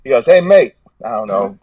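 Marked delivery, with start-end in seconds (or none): 0.3-0.7 higher, louder mock-Australian greeting; attempted Australian accent. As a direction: have a rough go at an Australian accent. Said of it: "I can't do an Australian accent".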